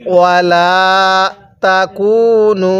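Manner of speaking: ওয়ালা তাকুনু slow, the লা held one alif; slow recited ওয়ালা তাকুনু; Quranic recitation. Shown as a demonstration. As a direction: chant ওয়ালা তাকুনু in measured tajweed. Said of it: "তাহলে".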